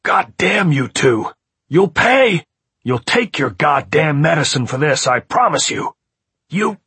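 loud, high, furious howling; howling rage. A narrator's reading. Man locked in vows revenge, howling furiously. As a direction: howl curses and threats through the door. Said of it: "he howled".

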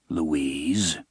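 plain reading voice

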